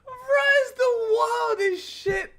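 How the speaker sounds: slow, high, laughing wail. Real, not acted. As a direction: cry it out through laughter. Laughing about the story.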